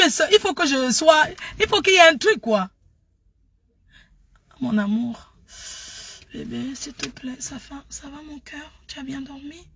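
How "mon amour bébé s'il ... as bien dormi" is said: very soft, tender lover's murmur; douce. Shown as a demonstration. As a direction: coo gently to a sweetheart, barely voiced. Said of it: "je sais être douce". (voix toute douce et tendre)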